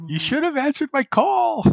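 very high, loud, scolding voice; Kirsten. Acted. Kirsten scolds Cecile over the comm, high voice. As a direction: scold in a squeaky high pitch.

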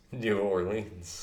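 New Orleans drawn out slowly, every syllable separate; full pronunciation. Shown as a demonstration. Insisting to a friend, over-pronounced New Orleans. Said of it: "have to pronunciate every single".

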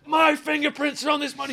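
high, loud laughing yell; loud frantic laugh-shout. Retold or imitated. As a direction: hurl it as an accusation. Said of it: "Pulls his wallet up".

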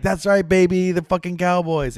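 high-pitched mock celebration; retold gloating. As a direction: crow it high, without shouting. Retelling friend's gloating after win.

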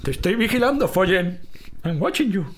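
high, far-off mock De Niro tough guy; De Niro impression. Shown as a demonstration. Doing De Niro for his podcast host. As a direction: play a menacing movie gangster. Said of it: "Robert De Niro habla así".

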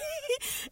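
high, real laugh; burst of laughter. Real, not acted. Recalling the boot joke, a high laugh bursting out. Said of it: "Eu começo a rir".

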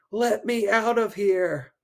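put-on trapped boy shouting for help; desperate shout. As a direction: cry out from inside the locker. Trapped in locker, crying out.

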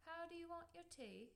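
"How do you want your tea?" very high-pitched English tune; English down inflection. Shown as a demonstration. Host demonstrating England's version, "tea" in high English tune. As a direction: put on an English question, high, not rising on "tea". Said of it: "in England, you would say".

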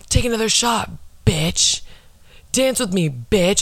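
imitating an aggressive drunk party girl; mock aggressive. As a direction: bark the bitch at them, half-hearted. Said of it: "the way she says bitch is just so aggressive".

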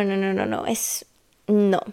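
normal talking voice

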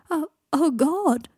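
loud, high, acted wail; crying. A narrator's reading. Greg breaks down at Draco's news, crying. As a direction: break down in tears on each word. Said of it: "he began to cry".

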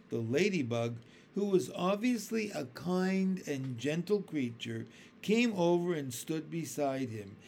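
ordinary delivery, nothing marked

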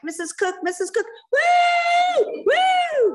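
high-pitched shouts into long whoops; excited calling out. Retold or imitated. Moms on the bus greet their friend, excited calling out. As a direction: call the name high, then whoop loudly.

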